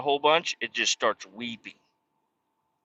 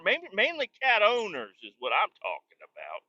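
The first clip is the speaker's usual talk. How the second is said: ordinary talk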